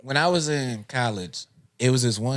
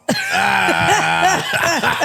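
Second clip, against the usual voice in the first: loud laughing shout; 3 dB louder than usual. Real, not acted. Laughing outburst at own joke.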